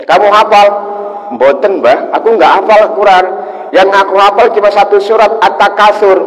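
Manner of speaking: lightly voiced boy admitting he knows one surah; boy's voice. Boy tells the kiai he only knows one surah, lightly voiced.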